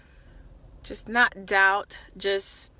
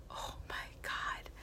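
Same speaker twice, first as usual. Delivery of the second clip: slow, hushed, stunned whisper; awed whisper; 16 dB quieter, slower than usual. Retold or imitated. Retold awed reaction to her story.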